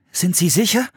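put-on high, hesitant child's question; questioning boy. A narrator's reading. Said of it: "fragte Philipp".

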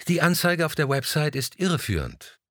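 indignant tenant raising her voice to the gallerist. A narrator's reading. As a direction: voice lifted high in protest. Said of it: "mit erhobener Stimme".

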